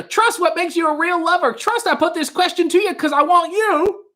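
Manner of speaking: very high-pitched comic impression, half-sung lyrics; Don Knotts. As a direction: recite song lyrics way up high, in a put-on voice. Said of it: "sung in the style of Don Knotts".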